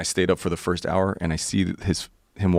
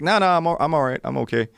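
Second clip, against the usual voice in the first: high, flustered mock protest; nervous refusal; 8 semitones higher, faster than usual. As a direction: squirm out of the offer.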